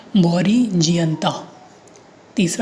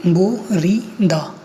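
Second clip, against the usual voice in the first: बोरीदा drawn out slowly, bo-ri-da; syllable by syllable; much slower than usual. Shown as a demonstration. Teacher introducing a new Korean word, बोरीदा slowly syllable by syllable. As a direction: read बोरीदा slowly with a gap after each syllable. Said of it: "इस slide में अपन पढ़ेंगे".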